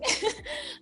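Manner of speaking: high quick giggle; high soft laugh; embarrassed giggle. Real, not acted. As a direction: laugh at herself. Laughing at French pronunciation.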